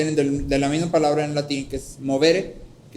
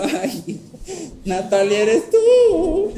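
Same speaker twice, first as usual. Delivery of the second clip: a high sung wail of the name, much higher at the end; frightened singsong call; 12 semitones higher, 4 dB louder, much slower than usual. Retold or imitated. Boy in bed calling to the ghost, sung and rising high. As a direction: sing out the question, voice climbing in fear. Scared child calls out singsong.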